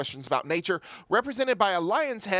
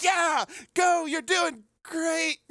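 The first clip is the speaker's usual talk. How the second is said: high cheering host shout; excited host; 11 semitones higher, much slower than usual. Retold or imitated. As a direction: pump up the contestant, very high. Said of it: "poor Mario Lopez is like".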